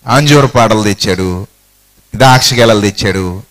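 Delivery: ordinary delivery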